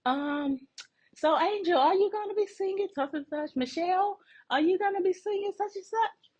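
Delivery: high, fast sing-song mimicry of a coworker; mock her high sugary greeting; high mocking imitation of Christina. Mocking the coworker greeting her and Angel in a high put-on voice. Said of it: "And she was like".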